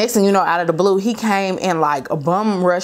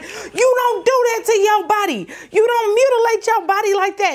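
angry dad yelling; 14 semitones higher than usual. Retold or imitated. Father discovering daughter's tattoo, yelling in anger. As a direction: explode at her like a livid father.

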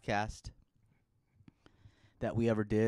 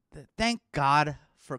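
ordinary talk

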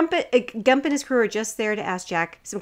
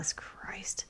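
very soft, voiceless mock grumble; exasperated whisper; 17 dB quieter, slower than usual. Friends react to girlfriend toast, mock exasperated whisper. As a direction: breathe it out in exasperation.

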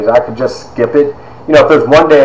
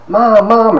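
put-on little boy calling; 11 semitones higher than usual. Child runs in calling mother.

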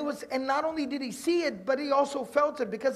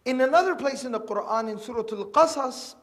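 ordinary delivery

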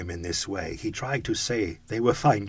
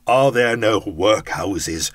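louder, slow, cutting retort; stern rebuke; 9 dB louder, slower than usual. Acted. Ghost turns Scrooge's words on him, loud stern rebuke. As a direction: hurl his own words back at him.

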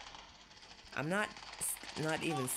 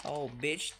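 ordinary talk, nothing marked

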